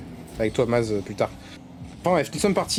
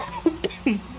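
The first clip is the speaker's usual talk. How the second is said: choking cough; sudden throat-clearing cough; coughing fit; 6 semitones higher, 5 dB quieter than usual. Real, not acted. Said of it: "je suis en train de m'étouffer".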